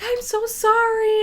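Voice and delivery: high, slow pleading apology; drawn-out, high-pitched mock apology; apologetic kid. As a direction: imitate a kid gushing an apology. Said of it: "the original kid comes back and he's like".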